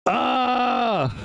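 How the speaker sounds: high-pitched outcry; real gamer scream; real anguished yell. Real, not acted. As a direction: cry out in defeat, voice shooting up. Screams out while losing in game.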